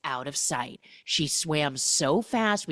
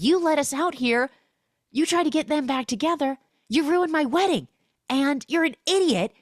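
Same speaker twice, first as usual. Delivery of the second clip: high, loud mock tirade; hammed-up furious rant at her; angry rant; 10 semitones higher, 4 dB louder than usual. Bride rails on Camilla, high and loud.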